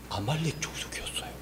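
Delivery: whispered, very soft and low; hushed reveal. Real, not acted. Pastor revealing the enemy tribe to children, hushed whisper.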